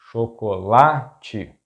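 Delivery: chocolate slowly split, sho - ko - la - chi; syllable by syllable. Shown as a demonstration. Teacher breaking down an example word, chocolate slow in syllables.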